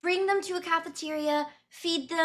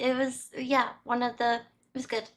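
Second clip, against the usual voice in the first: ordinary delivery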